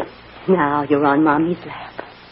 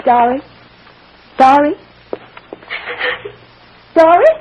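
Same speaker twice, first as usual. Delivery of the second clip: calls rising higher and louder each time; anxious calling; 6 semitones higher, 5 dB louder than usual. Acted. Mother searching the dark for Dolly, anxious calling. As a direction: call out for a missing child, more urgently.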